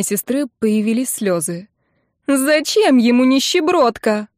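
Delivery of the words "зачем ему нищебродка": high, slow wailing question; tearful despair. A narrator's reading. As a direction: lament your own worthlessness, near tears. Tearful sister fears being dumped. (плаксиво, в отчаянии)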